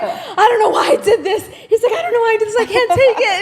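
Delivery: high, loud fake sobbing plea, laughing through it; imitating someone screaming and crying in regret; mock crying scream. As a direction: beg for it to stop in hammed-up agony. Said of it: "screaming and crying".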